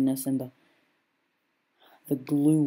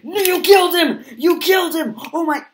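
very high, loud, horrified scream; 12 dB louder than usual. Acted. Onlooker screams in horror at the killing. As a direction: scream in shock at the death.

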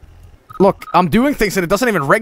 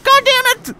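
very high mock-angry kid squeal; dismayed little Johnny. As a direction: curse like a squeaky eight-year-old. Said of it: "like, eight-year-old little Johnny".

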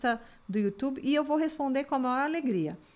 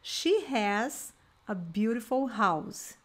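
slow, careful teacher modelling; slow model sentence; much slower than usual. Shown as a demonstration. Teacher showing the she form to students, slow. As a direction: lay it out slowly for learners. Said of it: "Se eu quisesse falar".

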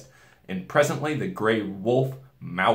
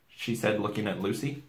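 ordinary delivery, nothing marked

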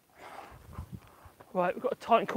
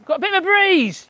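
higher, brighter call about the breeze; excited exclaim; 6 semitones higher, 4 dB louder than usual. Real, not acted. Showing the garden camera a gust, excited. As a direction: announce the gust with delight. Gust hits the greenhouse plastic.

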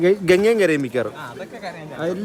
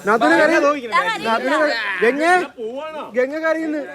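teasing call-out; 7 semitones higher than usual. Real, not acted. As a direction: egg them on, calling across the room. Teasing sister-in-law to cry.

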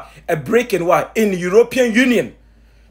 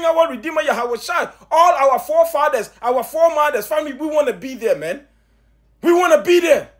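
higher, urgent preaching with rising calls; pumped up; 6 semitones higher than usual. Real, not acted. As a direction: fire them up, pleading and pushing. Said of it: "I am a little pumped up right now".

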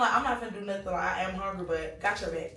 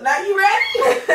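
excited; 11 semitones higher, 11 dB louder, slower than usual. Real, not acted. Teasing friend about juicy news.

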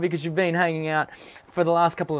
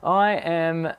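ordinary talk

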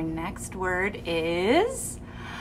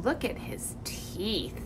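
hushed whisper; 2 semitones lower, 11 dB quieter than usual. Pretend. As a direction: breathe it out in a hush. Whispers about the dinosaur.

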